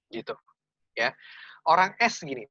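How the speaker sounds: ordinary talk, nothing marked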